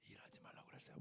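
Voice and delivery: very soft whispered answer. Acted. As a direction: whisper it like a secret prompt. Whispered answer to own question.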